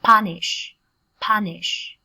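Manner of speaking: loud Punish with an open "ah" vowel; A sound in Punish. Shown as a demonstration. Teacher corrects students' vowel, loud open "ah" in Punish. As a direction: say Punish as "PAH-nish", firmly. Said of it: "Chúng ta đọc thành âm A".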